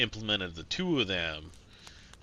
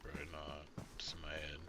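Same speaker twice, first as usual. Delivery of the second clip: whispered aside; 3 semitones lower, 12 dB quieter than usual. Real, not acted. Muttering to himself mid-game.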